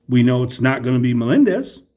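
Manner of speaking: ordinary talk, nothing marked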